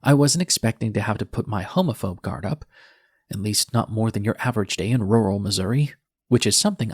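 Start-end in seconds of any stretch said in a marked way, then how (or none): none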